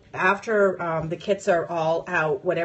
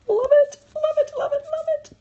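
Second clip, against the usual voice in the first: very high, louder sing-song repeat; gleeful squeal; 6 dB louder than usual. Real, not acted. Squeals over die cut stickers.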